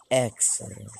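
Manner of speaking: low, drawn-out excellent; lightly voiced scheming old boss; Mister Burns impression. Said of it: "do an impression of his classic".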